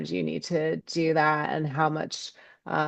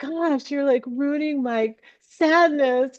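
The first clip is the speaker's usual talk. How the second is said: high-pitched, half-laughing whine; playfully aggrieved through pretend tears; mock tearful complaint; 10 semitones higher, 3 dB louder than usual. Speaker scolding a bluebird for ruining sadness, mock whiny.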